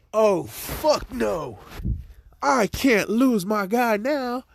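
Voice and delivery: high, slow, dragged-out panicked whine; mock panic. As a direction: wail it like she's about to lose her guy. Momo panics over losing Izuku.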